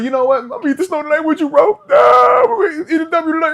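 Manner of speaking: high, loud, fast pep talk that bursts into a shout; imitating Jameis hype. Jameis firing up teammates, high shouted hype. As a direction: pump the team up like Jameis before a game. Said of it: "He be like".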